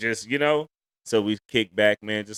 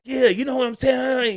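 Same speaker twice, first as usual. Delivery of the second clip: much higher, loud cheerful shout; loud happy talk; 5 dB louder than usual. Retold or imitated. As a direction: belt it like family chatter at a party. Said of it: "you talking like that".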